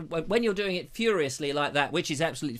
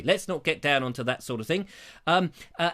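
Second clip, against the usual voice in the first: ordinary talk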